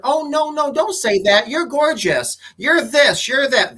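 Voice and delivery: high, gushing "oh no, don't say that"; reassuring a negative friend, high and gushing; gushing reassurance. Retold or imitated. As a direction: pile on the flattery in a high voice.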